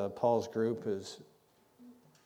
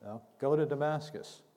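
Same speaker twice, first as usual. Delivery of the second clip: higher, louder insistent No, go to Damascus; firm voice of the Lord; 6 semitones higher, 4 dB louder than usual. Retold or imitated. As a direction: press go hard, correcting a doubter. The Lord answering Paul.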